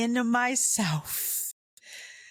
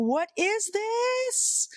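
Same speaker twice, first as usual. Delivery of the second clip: high, drawn-out mock-baffled question; exasperated bafflement; much slower than usual. Child trying to read dad's tone, comically baffled. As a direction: stretch it out slowly, pitched way up. Puzzling over dad's irritated tone.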